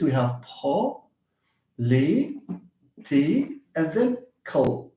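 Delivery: po, li, ti, kal split into separate beats; slow syllable-by-syllable reading. Shown as a demonstration. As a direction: break the word into syllables with pauses. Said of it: "look at this".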